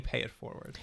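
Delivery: soft, almost whispered catchphrase; quiet catchphrase. Retold or imitated. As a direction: breathe it like a motto. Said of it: "They're always saying".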